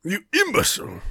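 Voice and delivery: high screeching mock villain; shrieking villain. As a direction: shriek it like a cartoon baddie. Quoting Goro mid-fight.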